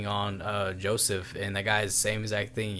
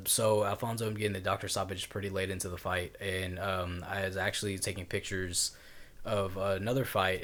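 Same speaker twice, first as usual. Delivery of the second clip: normal talking voice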